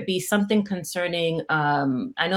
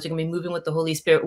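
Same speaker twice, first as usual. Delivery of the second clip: ordinary talk, nothing marked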